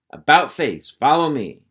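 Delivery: loud drill-sergeant order; shouted command. Acted. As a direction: bark the marching order.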